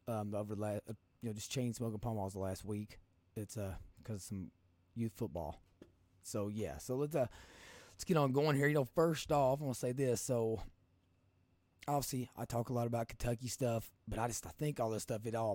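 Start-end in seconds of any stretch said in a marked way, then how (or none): none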